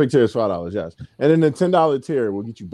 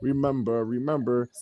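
softer echo; 2 semitones lower, 6 dB quieter than usual. Shown as a demonstration. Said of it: "we always start off with the same thing".

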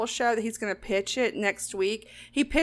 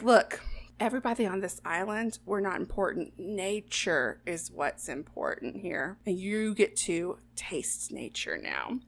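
hushed solemn chef; 2 semitones lower, 8 dB quieter than usual. Retold or imitated. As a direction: lecture the diners in a hush, slowing down. Chef solemnly lectures the diners.

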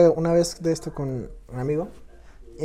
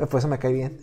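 usual speaking voice

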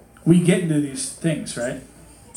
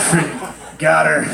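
slow, laughing gloat; dads gloating; slower than usual. Retold or imitated. Dads reacting to the fall, slow laughing gloat. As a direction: relish it like a smug dad. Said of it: "All the dads are like".

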